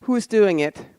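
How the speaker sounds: normal talking voice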